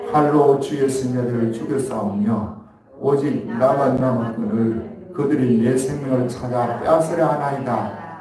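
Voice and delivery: steady, measured Bible reading to the church; scripture reading. Acted. Pastor reading scripture to the congregation, measured. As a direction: read the verse aloud, even and formal. Reads verse after "십 절 시작.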